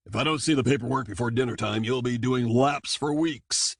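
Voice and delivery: a hint of a gruff officer's threat; stern shark captain. A narrator's reading. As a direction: shark captain threatening his soldier with laps.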